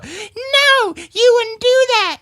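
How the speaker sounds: squeaky high-pitched puppet chatter, slow and drawn out. Acted. Hand puppet greeting Dan in a squeaky falsetto.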